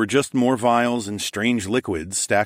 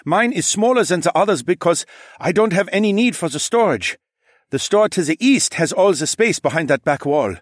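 higher, louder, eager shopkeeper; 11 semitones higher, 6 dB louder than usual. A narrator's reading. Shopkeeper explaining his shop to the lord, eager. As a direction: explain quickly to please.

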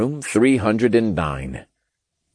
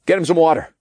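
raised, higher-pitched order; commanding; 9 semitones higher, 4 dB louder, much faster than usual. A narrator's reading. Narrator orders water for freed captive, commanding. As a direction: give a quick firm command. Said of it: "I commanded".

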